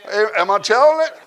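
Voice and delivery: loud, high revival shout, drawn-out words; full-force preaching. Acted. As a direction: preach it out to the whole church.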